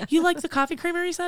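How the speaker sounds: quick, high-pitched quoted question; imitated friendly vendor. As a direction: mimic the rep asking, high and fast. Vendor voiced in retold story.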